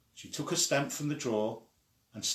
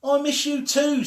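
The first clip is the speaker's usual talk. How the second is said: high, loud call across the street; child calling to her friend across the street; 9 dB louder than usual. Acted. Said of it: "They had to use loud voices".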